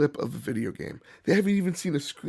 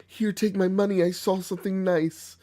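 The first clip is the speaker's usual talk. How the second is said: mock eager buyer; 6 semitones higher than usual. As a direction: gush like a dazzled shopper. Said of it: "this mentality of".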